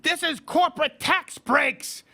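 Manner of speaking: high, slow mock shouting of a leftist; shrill mock protest shout; mocking Bernie rant. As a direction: ham up a ranting socialist's outrage. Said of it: "he wrote this socialist screed".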